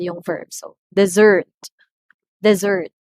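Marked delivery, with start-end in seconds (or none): 0.9-1.5 "dessert" with a loud, long SSERT; second-syllable stress. Shown as a demonstration. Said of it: "the second syllable receives the stress". 2.4-2.9 "dessert" with a loud, long SSERT; second-syllable stress. Shown as a demonstration. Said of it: "the second syllable receives the stress".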